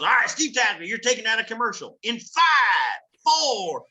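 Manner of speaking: high, loud Southern Texas countdown shout; Southern Texas accent. Retold or imitated. Southern Texas producer counting Steve into the broadcast, accent. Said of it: "in Southern Texas".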